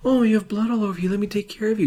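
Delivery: high, fast, fussing caretaker; mock nurturing voice. Host acting the girlfriend fussing over blood, high mock caring. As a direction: gush over him like a worried mother. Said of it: "You're trying to nurture him".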